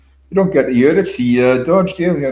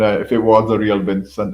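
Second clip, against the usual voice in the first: usual speaking voice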